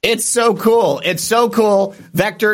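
thrilled by the gift; excited. Real, not acted. Delighted by gift flag.

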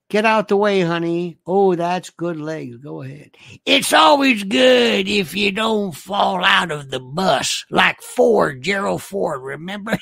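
hammed-up Southern accent, building to a holler; exaggerated Southern voice with stretched words; Southern affectation. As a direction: play it broad and Southern. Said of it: "I get to read it in this voice".

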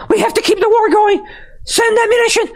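hammed-up high panic voice; mock panicking officials. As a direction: squeal it like a panicked bureaucrat. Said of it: "Is that the reason they're panicking".

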